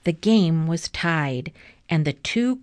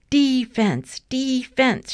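high, repeated sideline shout; 6 semitones higher, 3 dB louder than usual. A narrator's reading. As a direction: call the team back to defend. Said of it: "shouted Arthur's dad".

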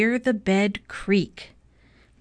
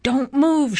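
higher-pitched urgent warning; shouted warning; 7 semitones higher, 4 dB louder than usual. A narrator's reading. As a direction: call out a mild storybook shout. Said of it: "shouted Mister Watson".

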